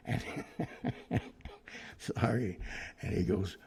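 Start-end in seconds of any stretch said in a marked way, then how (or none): 0.3-1.9 quick unvoiced laugh burst; real laughter at the prank; airy chuckle; breathy laugh. Real, not acted. Laughs again.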